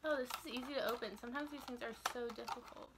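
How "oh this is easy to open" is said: ordinary delivery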